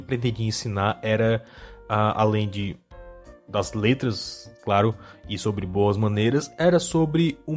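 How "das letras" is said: normal talking voice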